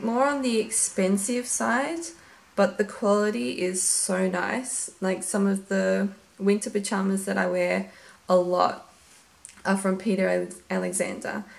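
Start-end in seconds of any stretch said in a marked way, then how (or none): none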